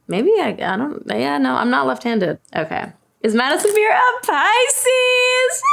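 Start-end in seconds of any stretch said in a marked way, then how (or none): 3.2-5.6 high, drawn-out mock-excited shout; hammed-up excitement. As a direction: shout the question slowly, building to a squeal. Hammed-up reading of a question.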